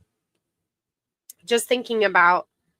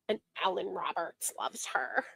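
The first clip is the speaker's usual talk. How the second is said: breathy whispered aside, much softer; 4 semitones lower, 13 dB quieter than usual. Real, not acted. Whispering a gossipy aside to viewers. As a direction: whisper it like a secret.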